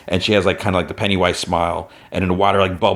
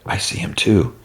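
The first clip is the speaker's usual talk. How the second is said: normal talking voice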